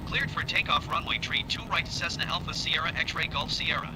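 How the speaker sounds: normal talking voice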